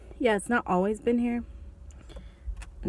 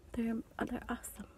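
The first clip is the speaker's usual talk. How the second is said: breathy whispered aside to camera; 3 semitones higher, 12 dB quieter than usual. Real, not acted. Whispering to viewers about loving mukbangs. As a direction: drop to a hushed whisper.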